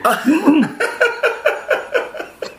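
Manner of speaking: high burst of laughter; delighted laugh. Real, not acted. Laughs at girl's hungry reply.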